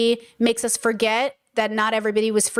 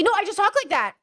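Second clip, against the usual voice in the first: higher-pitched defensive protest; mock someone getting defensive; 9 semitones higher than usual. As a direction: act out a put-upon objection. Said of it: "you're gonna get defensive".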